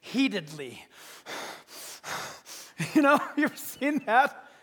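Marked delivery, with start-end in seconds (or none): 0.9-2.9 heavy, huffing angry breaths; heated breathing. Shown as a demonstration. Preacher shows what wrath means, heated breathing. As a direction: huff heavily through the nose. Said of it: "means to breathe heatedly".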